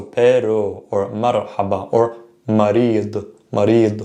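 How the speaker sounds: "pero", "marhaba", "marid" with single tapped R, slowly; same ra sound. Shown as a demonstration. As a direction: tap each R lightly, "marid". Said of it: "the same ra sound".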